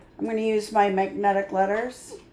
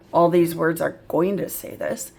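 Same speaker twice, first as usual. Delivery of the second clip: ordinary delivery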